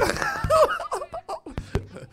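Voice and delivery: high-pitched drawn-out cackle; cracking up at your own joke; long high laugh. Real, not acted. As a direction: let out a long, gleeful laugh.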